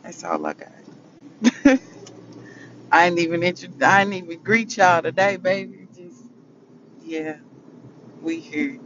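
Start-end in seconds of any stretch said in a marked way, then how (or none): 1.2-2.9 a bright laugh breaking out high and loud; sudden loud laugh; burst of laughter. Real, not acted. Laughs after ending her message.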